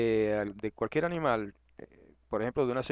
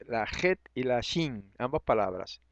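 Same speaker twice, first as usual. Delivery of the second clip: normal talking voice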